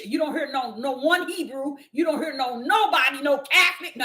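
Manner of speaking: ordinary talk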